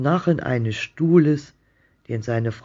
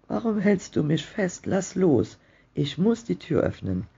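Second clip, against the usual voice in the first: higher-pitched, urgent wife; mildly frantic, pitched up at the start; pleading protest; 6 semitones higher than usual. A narrator's reading. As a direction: protest to be let go, without shouting. Wife struggles in husband's grip.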